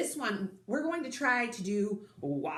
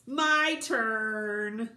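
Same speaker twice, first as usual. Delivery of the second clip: loud-ish, drawn-out demand; grabby little sister; 3 semitones higher, 4 dB louder than usual. A narrator's reading. Sister grabbing Gorilla from Sophie, demanding. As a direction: claim it with stretched words. Sister grabs the toy in story.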